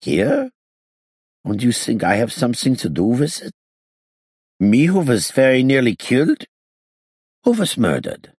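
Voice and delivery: put-on high, accented indignant questions; incredulous gangster. A narrator's reading. Gangster protesting suspicion to the inspector, high incredulous voice. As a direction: question in disbelief, pitch high, W said as V. Hincus reacts to murder news.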